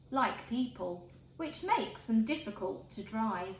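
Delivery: plain reading voice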